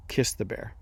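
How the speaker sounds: a hint of a divine voice, low and quiet; voice of God. God answers the fleeing dreamer, understated low command. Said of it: "Voice of God says".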